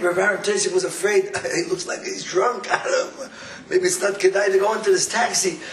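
ordinary talk

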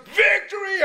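crowd roar. Retold or imitated. Soldiers roaring back at the king. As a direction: roar it as one army. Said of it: "And all the soldiers".

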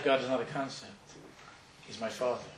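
tender sincerity. Acted. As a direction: sink gently into quiet, heartfelt certainty. Old man's heartfelt closing confession.

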